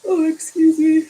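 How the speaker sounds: words stretched by a real yawn; talking through a yawn. Real, not acted. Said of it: "there's yawning happening".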